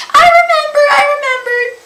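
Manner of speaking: very high, loud little squeal; piercing high-pitched outburst. Real, not acted. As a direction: squeal it out on recalling. Suddenly recalling the booked reading.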